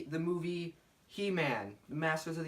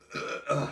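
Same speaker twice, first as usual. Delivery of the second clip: loud throat clear; 6 dB louder than usual. Real, not acted. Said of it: "Excuse me".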